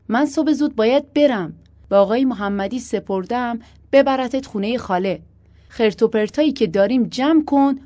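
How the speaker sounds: forceful pleading reassurance; urgent persuasion. A narrator's reading. Husband insists, promises, reassures.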